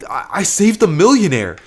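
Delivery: high-pitched, stammering excitement; excited disbelief. Acted. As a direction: break incredible news to mom, stumbling over it. Son breaking money news to mother.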